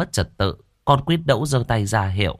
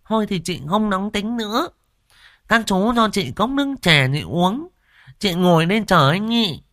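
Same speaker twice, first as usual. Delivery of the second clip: mollified brash woman; 11 semitones higher than usual. Acted. Quýt Nẫu calming down and asking for tea, high and coy. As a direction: settle down, still bossy.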